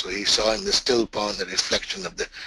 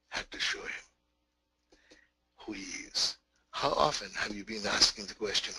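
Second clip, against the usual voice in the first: softer, intimate sermon voice; hushed preaching; 2 semitones lower, 9 dB quieter than usual. Acted. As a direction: lean in and confide the lesson quietly.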